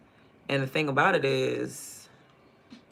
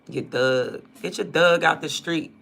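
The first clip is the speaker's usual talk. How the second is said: put-on Baltimore accent; 3 semitones higher, 7 dB louder than usual. Imitating Baltimore folks telling off a dog owner, Baltimore accent. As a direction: Baltimore accent, louder, bossy. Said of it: "They be like".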